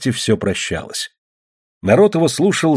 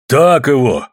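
drawn-out cheering call; shouted encouragement; 4 semitones higher, 5 dB louder, slower than usual. A narrator's reading. Brother Sanka cheers the fight, shouted encouragement. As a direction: call it out to egg her on. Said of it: "крикнул его брат Санка".

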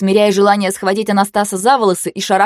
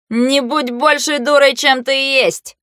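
high, slow near-bark; 8 semitones higher, 3 dB louder, slower than usual. Acted. Anastas nearly barks at Blau, high and slow. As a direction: bite the words out at her. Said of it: "почти рявкнул Анастас".

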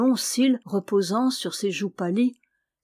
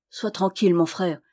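usual speaking voice

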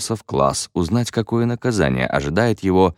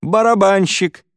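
very high, excited yell; yelled guess; 13 semitones higher, 4 dB louder than usual. A narrator's reading. Pupil repeating his guess, high-pitched yell. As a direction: yell it at the top of your pitch. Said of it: "завопил Картрайт".

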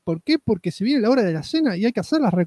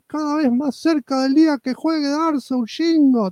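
mock shout; 8 semitones higher than usual. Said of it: "te grita".

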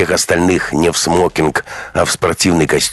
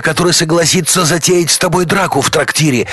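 crying plea; 11 semitones higher than usual. Acted. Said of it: "текут слёзы. Он плачет".

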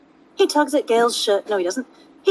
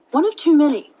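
said sharply; 6 semitones higher, 4 dB louder than usual. Acted. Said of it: "Astarion says sharply".

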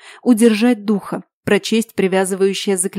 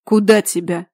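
cold and unfriendly. Acted. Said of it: "Холодно и недружелюбно".

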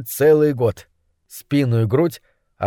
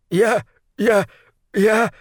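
mumbling stammer; 7 semitones higher, slower than usual. Acted. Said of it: "забормотал крысолов".

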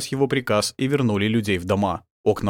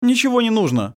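lightly voiced higher young man; raised youthful voice; 12 semitones higher, 4 dB louder than usual. A narrator's reading. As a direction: answer as a young rescuer. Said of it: "ответил молодой голос".

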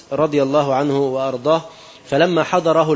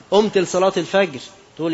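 ordinary delivery

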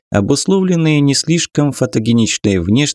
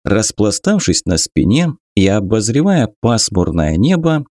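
ordinary delivery, nothing marked

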